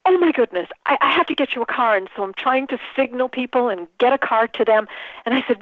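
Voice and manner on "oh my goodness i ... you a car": higher, mildly panicked retold outburst; flustered panic. Organizer realizing she must find the speaker a car, flustered. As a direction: act out a sudden flustered alarm, pitched up. Retells her panicked rush.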